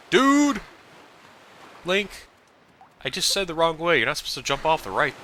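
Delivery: high, loud frustrated shout at the hero; real exasperated yell. Real, not acted. Player yelling at Link for going the wrong way, exasperated shout.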